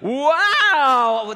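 long, loud, high drawn-out "wow"; amazed wow. Acted. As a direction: stretch it out in big hammed-up amazement. Acted amazed reaction in story.

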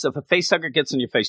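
usual speaking voice

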